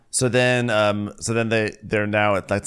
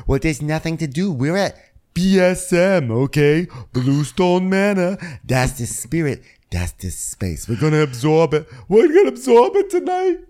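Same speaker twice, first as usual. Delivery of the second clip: Dorinda impression; 8 semitones higher, 4 dB louder than usual. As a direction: savor each phrase, building to a loud high squeal. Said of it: "Dorinda's telling us, like".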